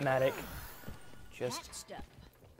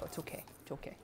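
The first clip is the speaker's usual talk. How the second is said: very soft, hushed reassurance; calm a startled pet quietly; gentle, low-volume comforting; soothing; 14 dB quieter, much faster than usual. Real, not acted. Soothing his startled pet lizard.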